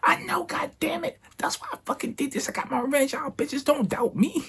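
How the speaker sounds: very high, strained mock yell; hammed-up triumphant rant without extra volume; turned up, hyped. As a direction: crow at the doubters in a squeal. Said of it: "I just turned up".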